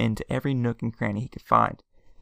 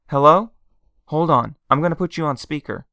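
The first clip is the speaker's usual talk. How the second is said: louder, pitched up and urgent; eager phone answer; 4 semitones higher, 8 dB louder than usual. A narrator's reading. Aunt answering her missing husband's call, eager and loud. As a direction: grab the call before it drops.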